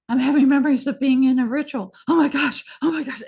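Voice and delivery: mock the shaken man remembering a ritual; hint of rising alarm, hammed lightly; freaking out. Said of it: "he's, like, freaking".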